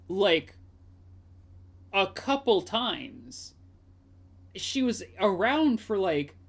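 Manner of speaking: loud, drawn-out disbelief with swooping pitch; incredulous. Real, not acted. Streamer disputing Phoenix's good times, incredulous. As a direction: challenge it, stretching the key words.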